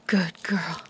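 normal talking voice

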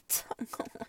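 fast breathless whisper. Acted. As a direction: rush it out in a hush. Intimate whispered roleplay.

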